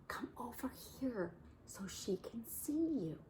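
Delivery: soft unvoiced whisper as Missus Duck. Missus Duck whispers to Bear beside the baby, hushed. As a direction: hush so the baby isn't startled. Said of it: "Missus Duck whispered to him".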